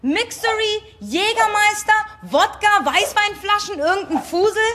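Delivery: ungläubig Flaschen aufzählen; vorwurfsvoll, Flasche für Flasche; empört aufzählend. Acted. Zählt empört Flaschen auf. (empörte Aufzählung)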